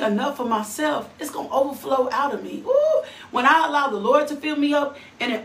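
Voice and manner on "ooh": high, loud thrilled ooh; a burst of holy excitement; excited ooh. Real, not acted. Preacher stirred by the verse.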